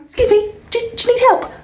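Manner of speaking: high, loud pushy shop assistant; chirpy salesperson pouncing on a customer; mock shop assistant. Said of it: "they're gonna be like".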